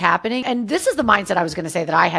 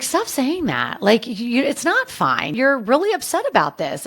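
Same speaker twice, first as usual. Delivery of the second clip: a mom's higher, insistent voice, mocked up; imitating her mom; 7 semitones higher than usual. Mom telling Amber to stop saying it's fine, higher and pressing. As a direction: play the mother cutting her daughter off. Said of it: "And she's like".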